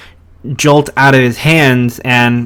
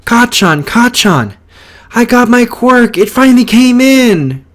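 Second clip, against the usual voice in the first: high, loud, thrilled shouting; excited yell; 10 semitones higher, 4 dB louder than usual. Retold or imitated. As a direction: yell it out with pure joy, much higher. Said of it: "he yells".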